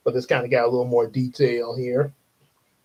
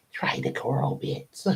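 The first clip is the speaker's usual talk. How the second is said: whispered hint; 2 semitones lower, 8 dB quieter than usual. Acted. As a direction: whisper the suggestion aside. Whispered suggestion in episode.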